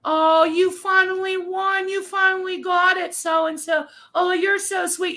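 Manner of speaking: high-pitched gushing, mock congratulation; babying. Chat members congratulate the winning lady, mock babying. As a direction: coo over her in exaggerated sweetness. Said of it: "Babying her".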